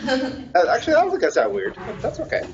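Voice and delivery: high, fast talk through laughter; giggling as you answer; laughing. Real, not acted.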